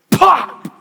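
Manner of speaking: sudden loud, high burst; explosive pop. Retold or imitated. Water blows up in the microwave, sudden loud pop. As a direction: imitate a bang out of nowhere.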